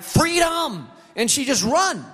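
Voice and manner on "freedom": dog's joyful cry. Retold or imitated.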